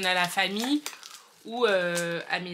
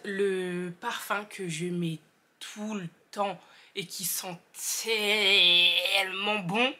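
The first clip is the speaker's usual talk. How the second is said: ordinary delivery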